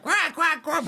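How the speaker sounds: loud, very high squawked quacks; quacking bird. Acted. What Flappy really sounds like, high quacking. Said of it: "it's just like".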